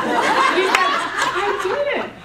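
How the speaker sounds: high, loud laughing no; husband laughing as he says it to his wife, high and loud; laughing denial. Retold or imitated. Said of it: "He said".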